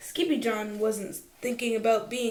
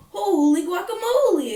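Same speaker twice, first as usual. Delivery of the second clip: higher, louder cry of surprise; excited exclamation; 9 semitones higher, 8 dB louder than usual. Acted. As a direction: blurt it out, amazed at the mirror. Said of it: "exclaimed Skippy-John Jones".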